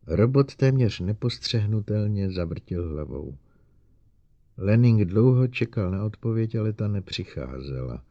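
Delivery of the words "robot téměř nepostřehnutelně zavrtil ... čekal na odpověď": usual speaking voice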